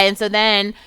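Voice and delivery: ordinary talk, nothing marked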